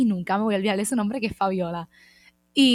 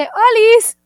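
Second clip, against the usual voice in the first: higher, louder playful "Olis"; bright greeting; 13 semitones higher, 16 dB louder than usual. Retold or imitated. Girl passing by greets her, a loud high "Olis". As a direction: sing out "Olis" to a stranger. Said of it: "me pasa una nena y me dice".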